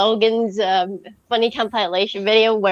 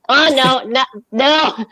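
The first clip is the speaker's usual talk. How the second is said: normal talking voice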